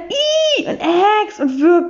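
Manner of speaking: high shrieking "Ih", "Äx", "Wirk"; disgusted scream. Acted.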